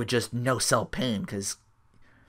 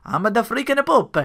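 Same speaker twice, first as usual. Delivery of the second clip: higher, louder cocky wrestler voice, falling at the end; lightly voiced arrogant champion; mock Pope boast; 10 semitones higher, 4 dB louder than usual. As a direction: brag it like a heel on the mic. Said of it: "But I'm like".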